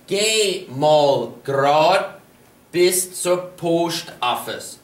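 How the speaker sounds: slow, deliberate teaching delivery; slow model sentence. Shown as a demonstration. Teacher models going straight to post office, slowly. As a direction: lay out each word slowly for learners. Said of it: "For example".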